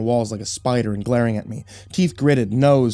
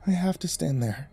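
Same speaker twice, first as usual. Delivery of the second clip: soft, drained insistence; subdued explanation; 3 semitones higher, 7 dB quieter than usual. A narrator's reading. Calmed-down wife explaining to husband, subdued. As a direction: explain it quietly, worn out. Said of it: "she explained after gulping down".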